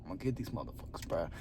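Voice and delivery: low, hushed, fast menacing grandma. Retold or imitated. Imitating grandma plotting against sneaking kids, low and hushed. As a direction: mutter a threat like a lurking old lady. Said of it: "but she's just like".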